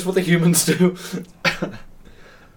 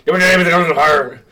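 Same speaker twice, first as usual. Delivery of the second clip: quick, loud mock wizard accent; angry gibberish Dumbledore; 4 semitones higher, 7 dB louder, faster than usual. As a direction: bark it like a cross old wizard. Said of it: "It's angry gibberish".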